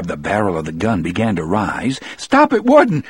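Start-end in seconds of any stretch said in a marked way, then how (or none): 2.2-3.0 high, loud panicked cry; urgent frightened shout, pitch leaping up; shaken alarm. A narrator's reading. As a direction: cry out to stop him, voice full of shakes. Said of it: "never heard his voice sound the way".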